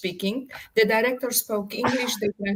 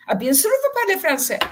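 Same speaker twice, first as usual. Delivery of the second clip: French crew voice; 8 semitones higher, 4 dB louder, faster than usual. Retold or imitated. Crew's disbelieving reply.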